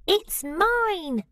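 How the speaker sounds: high, eager squirrel claim; squirrel shouting. Acted. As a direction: call it out as if grabbing first. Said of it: "shouted Cyril".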